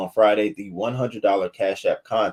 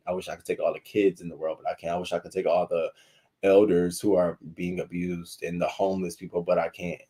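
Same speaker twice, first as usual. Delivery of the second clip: ordinary talk, nothing marked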